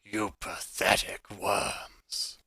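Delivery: slow, loud, menacing dragon; booming dragon voice. Acted. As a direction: boom it out slowly like a huge beast. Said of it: "booms out loud in the tunnel".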